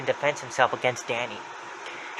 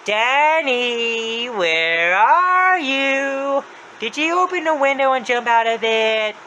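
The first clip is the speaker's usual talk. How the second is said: long, sing-song searching call; Happy's voice; 10 semitones higher, 5 dB louder, much slower than usual. Retold or imitated. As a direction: swoop high and loud, stretching each word. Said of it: "he opened it and said".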